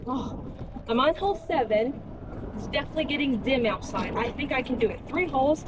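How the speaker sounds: ordinary talk, nothing marked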